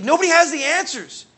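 high, loud, exasperated retold cry; impassioned outcry. Young man telling pastor what he found, crying out. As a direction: cry it out as the truth dawns.

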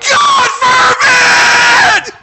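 God forbid shouted very high and loud, slowly; screamed to the heavens. Shown as a demonstration. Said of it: "What it says is".

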